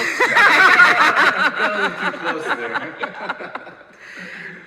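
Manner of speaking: long, loud, high burst of laughter; big laugh. Real, not acted. Host reacts to the audience comment with a loud long laugh. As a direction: let out a big real laugh.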